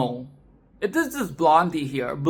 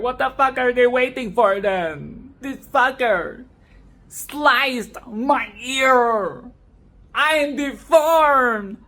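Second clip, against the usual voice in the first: higher, slow outraged complaint; mock wounded cop; 5 semitones higher, 4 dB louder, slower than usual. Reviewer imitating the maimed officer complaining, higher and slow. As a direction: drag out the indignant whine. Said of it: "the police officer here is like".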